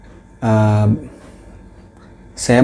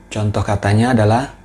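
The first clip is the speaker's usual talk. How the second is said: usual speaking voice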